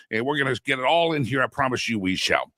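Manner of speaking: normal talking voice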